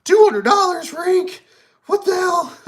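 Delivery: very high, loud disbelieving yell; outraged shout. Retold or imitated. Buyer shocked by ink price, high outraged shout. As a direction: shriek at the price tag in mock outrage. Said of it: "and you're like".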